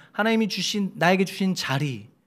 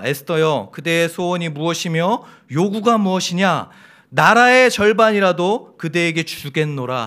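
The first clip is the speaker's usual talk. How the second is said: solemn scripture reading; 7 dB louder than usual. Shown as a demonstration. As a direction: declaim it like a royal decree. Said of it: "삼 절에 이렇게 얘기합니다".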